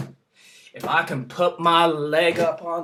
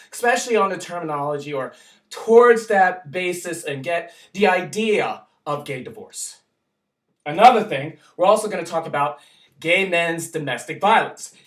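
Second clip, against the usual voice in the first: ordinary talk, nothing marked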